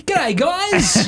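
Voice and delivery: very high, hammed-up cheery greeting; chirpy visiting speaker. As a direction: squeal a self-mocking hello to the crowd. Mimics himself as chirpy visiting speaker.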